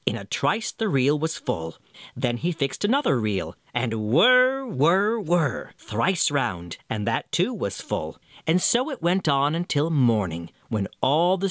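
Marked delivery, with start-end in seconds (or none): 4.1-5.7 spinning whir. A narrator's reading. Spinning wheel sound.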